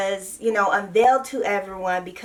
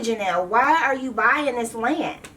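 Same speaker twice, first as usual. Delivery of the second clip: ordinary delivery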